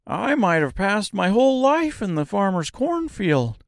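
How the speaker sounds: high, excited scarecrow voice; Scarecrow crying out. A narrator's reading. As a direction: exclaim it like a proud scarecrow. Scarecrow speaking.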